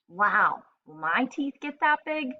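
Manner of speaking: put-on a touch of childlike excitement; asked excitedly. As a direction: ask it with a little one's excitement. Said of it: "she asked excitedly".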